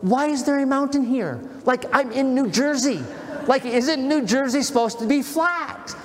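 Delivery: pitched high, incredulous at the hill; exasperated disbelief. Retold or imitated. Pastor retelling his thought on the climb, exasperated and incredulous. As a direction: whine it like a weary runner betrayed by the terrain. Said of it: "my first thought was".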